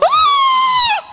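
very high loud scream; high shriek of shock. Acted. Man sees the phone bill, high shocked scream.

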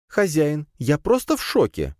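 high-pitched spider pet, amazed; astonished exclamation. A narrator's reading. Spider to master after his magic, astonished. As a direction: exclaim in a small, high creature's voice. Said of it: "воскликнула паучиха".

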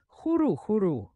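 high, falling hoot; exclaimed owl call. A narrator's reading. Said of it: "вигукнув Мерлін". (високий вигук, як совиний крик)

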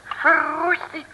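high, slow astonished gasp of words; detective stunned by a grenade in a beard; startled exclamation. Acted. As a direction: react as if you can't believe your eyes.

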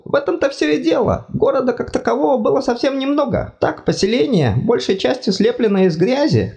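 high fussy god voice, apologetic; embarrassed god. Acted. God tells of Quint.

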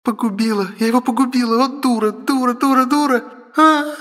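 very high, panicked wail; hysterical despair. Acted. Sister thinks baby is dead, high hysterical wailing. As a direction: wail in self-blame, building to a cry.

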